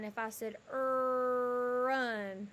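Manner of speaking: "Run" said slowly with the r drawn out; teacher modelling "Run" sound by sound. Shown as a demonstration. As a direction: stretch the r at the start of "Run" for the class. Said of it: "what was the sound that you heard".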